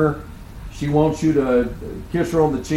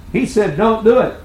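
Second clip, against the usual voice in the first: voicing wife; 7 semitones higher than usual. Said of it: "she said".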